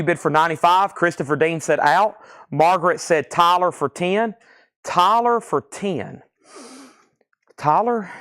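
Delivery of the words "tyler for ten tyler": slow and higher, puzzled over the name; rereading a bid that makes no sense; puzzled repeat. Real, not acted. Host puzzles over a strange chat bid, slow and higher. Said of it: "What was Tyler?".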